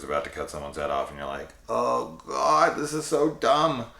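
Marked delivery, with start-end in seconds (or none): 1.7-3.9 high, mock-exasperated groan. Viewer reacting to a dumb kill, exasperated. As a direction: put on a viewer's pained disbelief.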